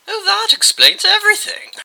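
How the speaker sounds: high, loud mock exclaim; sarcastic squeal of disbelief; sarcastic retort. A narrator's reading. Bastings sarcastic reply.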